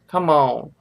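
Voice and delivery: ordinary delivery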